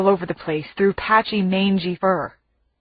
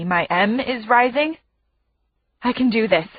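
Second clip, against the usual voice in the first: ordinary delivery, nothing marked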